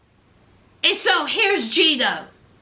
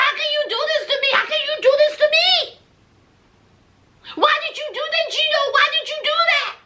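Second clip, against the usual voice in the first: high, fast, upset accusing; mock furious wife screaming at her husband; acted angry quote; 8 semitones higher, much faster than usual. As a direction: fire off the accusations at Gino. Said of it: "And she said".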